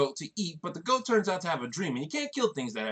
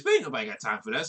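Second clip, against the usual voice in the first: lower-pitched meme quote; meme catchphrase voice; 6 semitones lower than usual. Retold or imitated. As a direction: toss off the viral line in a lower voice.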